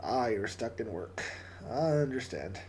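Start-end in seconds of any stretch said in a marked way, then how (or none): none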